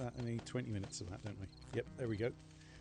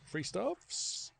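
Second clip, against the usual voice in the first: playful high echo of his own words; 8 semitones higher than usual. Shown as a demonstration. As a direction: repeat it higher, teasing. Echoes his own phrase.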